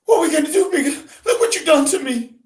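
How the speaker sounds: high, tearful wail; crying. Acted. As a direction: cry out in despair. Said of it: "She began to cry".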